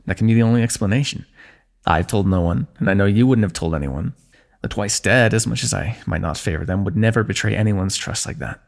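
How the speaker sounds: ordinary talk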